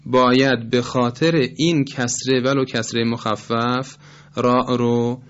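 ordinary delivery

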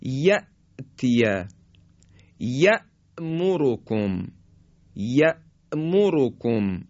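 یأتیه and یأمرکم slow, with the silent hamza caught sharply in the throat; sharp sakin hamza. Shown as a demonstration. Teacher demonstrating the sakin hamza slowly. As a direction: stop hard on the hamza in یأمرکم.

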